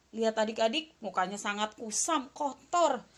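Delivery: ordinary delivery, nothing marked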